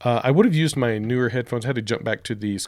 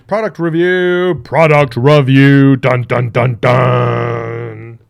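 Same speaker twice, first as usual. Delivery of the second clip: loud announcer jingle; 13 dB louder, much slower than usual. Acted.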